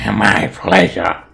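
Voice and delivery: slow, loud villain's minion voice; Barnacle. Acted. Minion answers the hag's order, slow loud monster voice. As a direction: savour it slowly in a creature voice. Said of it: "Barnacle says".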